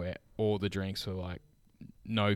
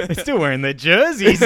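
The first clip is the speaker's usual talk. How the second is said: laughing; 9 semitones higher, 11 dB louder than usual. Real, not acted. Laughing recalling players' scarves.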